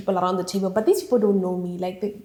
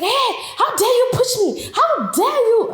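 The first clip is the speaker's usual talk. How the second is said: very high-pitched, outraged imitation of a man; mock scandalised outburst; mock yelling; 13 semitones higher, 3 dB louder than usual. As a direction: act out his indignant yell without full volume. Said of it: "he's yelling this time".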